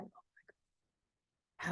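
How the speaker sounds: very slow, breath-only whisper; swooning whisper. Retold or imitated. Woman hearing a cute chuckle in a voice note, swooning whisper. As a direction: whisper it, drawn right out.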